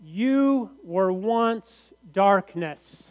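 very slow, emphatic scripture reading. Acted. Preacher reads Ephesians to the congregation, slow and emphatic. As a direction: declaim the verse, drawing out each word. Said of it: "it goes like this".

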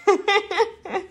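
high-pitched run of giggles; real laughter. Real, not acted. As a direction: burst into high laughter at yourself. Laughs at own crying expertise.

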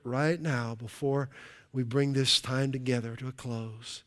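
soft, hushed prayer with long pauses; closing prayer. Real, not acted. Preacher prays to the Father to close the service, hushed prayer. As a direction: pray gently, fading almost to a whisper by the amen.

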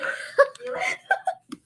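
high, cackling jeer; jeering laugh. A narrator's reading. Villagers laughing at the girl with the pot, jeering. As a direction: laugh at her to humiliate her.

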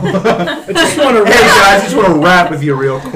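chirpy youth minister voice, laughing; upbeat youth pastor. Pretend. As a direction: play the overeager church youth leader. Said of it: "if you go youth minister on us".

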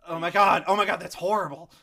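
loud, aghast reaction; mock horror. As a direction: act out being appalled for the customer. Reacting to angry customer in story.

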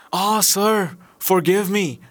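high, imploring merchant; pleading cry. A narrator's reading. Merchant begging the genius for forgiveness, high pleading cry. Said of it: "cried the merchant".

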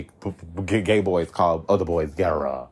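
usual speaking voice

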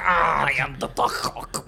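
slow, loud alien drone; Martian robot voice. Pretend. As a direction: shout like a clunky Martian. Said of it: "that's how the fucking Martians talk".

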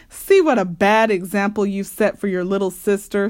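ordinary delivery, nothing marked

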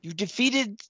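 a fawning little goblin thanking his rescuers; grateful goblin. Acted. Game master voices the goblin thanking the party, high and squeaky. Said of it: "and he says".

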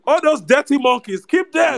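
a high sneering mock voice. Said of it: "It's like".

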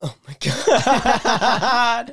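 high, slow laughing shriek; startled laugh stretched out. Real, not acted. Startled by a loud sound.

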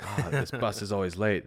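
mock grumble of a fed-up passenger; imitated complaining. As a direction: complain lightly, as if quoting a rider. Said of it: "who's just complaining".

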